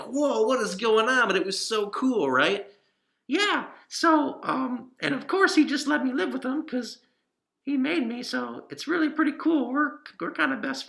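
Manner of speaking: Pippin the cat puppet. Acted.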